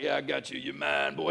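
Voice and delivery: low, soft player's gloat; muttered taunt. Pretend. Football player standing over a flattened opponent, muttered taunt. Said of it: "be like".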